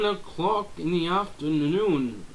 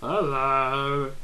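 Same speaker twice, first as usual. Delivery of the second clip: ordinary talk, nothing marked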